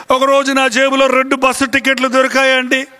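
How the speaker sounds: normal talking voice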